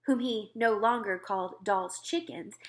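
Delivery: usual speaking voice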